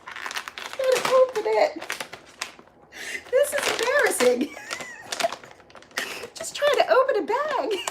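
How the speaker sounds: high, giggly fluster; giggling while struggling to open a treat bag; embarrassed laughing. Real, not acted. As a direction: laugh at yourself for failing with the bag.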